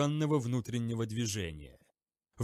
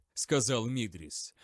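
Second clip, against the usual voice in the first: plain reading voice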